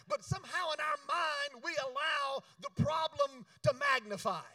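high, loud preacher's cry; preaching shout. Acted. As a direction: drive the point home to the crowd. Preaching at full force.

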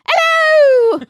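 very high, loud shrieked "Hello!"; Mrs Doubtfire. Retold or imitated. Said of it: "sound like Missus Doubtfire".

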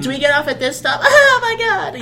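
put-on high squealing teen girl; shrill giggly fangirl at the station.

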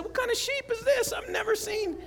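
put-on high child's voice, puzzled; imitating a girl puzzled by a strange sheep. Quoting Alayla puzzled by the sheep picture, high child's voice. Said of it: "And Alayla said".

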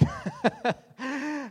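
high laugh at himself; self-deprecating laugh. Real, not acted. Preacher admitting he just kept walking, self-deprecating laugh. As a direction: laugh at your own failing.

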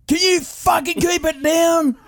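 high-pitched, drawn-out exasperated dad yell; irate dad. Retold or imitated. Dad complains about late-night printing, mock irate yell. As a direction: holler it slowly as a fed-up father. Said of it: "and he'd go".

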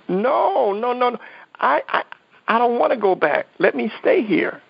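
high, desperate pleading. Retold or imitated. Man begging God to let him stay, high desperate plea.

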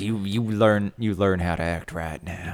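low, hushed scolding dad; mock menace, dropping to a near whisper; pissy dad. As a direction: imitate a father's quiet threat. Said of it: "he's all shitty and pissy again".

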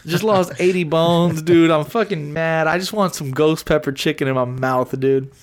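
ordinary talk

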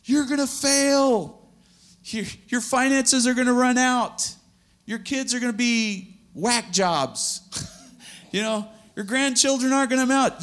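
high, loud, taunting shriek; screaming flesh voice. Acted. The flesh screaming threats into your ear, shrill taunt. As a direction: nag like a shrill inner voice of doom. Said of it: "the flesh is screaming in your ear".

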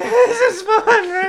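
high, loud, drawn-out mock desperation; psychopath dad. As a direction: demand agreement as an unhinged dad, hammed up. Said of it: "Just a psychopath dad".

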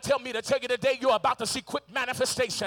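loud, driving sermon climax; preacher urging the congregation to speak it aloud; preaching at full force. Acted. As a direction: push each line harder to the crowd.

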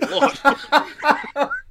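high, loud mock "What?"; mock shocked listener. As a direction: act out a scandalized dad, half laughing. Imagined dad shocked by swearing.

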